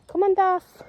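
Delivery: high call to the dog. Real, not acted. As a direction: call the dog to come along. Calls the dog while walking.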